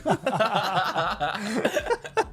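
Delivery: laughing hard, high. Real, not acted. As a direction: crack up while talking. Cracking up at Shrek joke.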